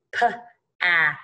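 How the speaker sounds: "p, ass": p alone, pause, then rime; sounded out. Shown as a demonstration. Teacher reviews pass with one s, sounded out. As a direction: split "p, ass" before blending. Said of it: "only going to make one of those letter".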